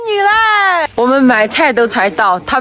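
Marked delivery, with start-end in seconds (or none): 0.1-0.9 yelling in happy surprise; excited shout. Real, not acted. Surprise at early guests arriving.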